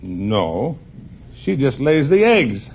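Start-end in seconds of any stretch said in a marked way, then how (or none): none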